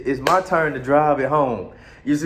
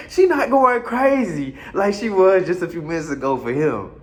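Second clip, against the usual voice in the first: normal talking voice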